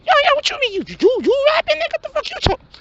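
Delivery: high, loud, incredulous street voice; hustler friend. Acted. Hustler friend scoffs at his rap plan, high and loud. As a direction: shoot it up high in disbelief.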